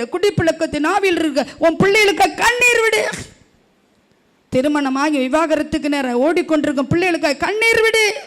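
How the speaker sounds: loud, high pleading exhortation; impassioned preaching. Acted. Pastor urging parents to weep for lost children, loud and high. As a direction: build the call to weep.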